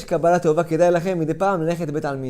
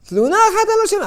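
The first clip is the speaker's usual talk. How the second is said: much higher and faster, exclaimed; high emphatic repeat; 5 dB louder, faster than usual. Acted. Preacher marveling that the dead never complain, high exclaimed. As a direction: fling it up high in amazement.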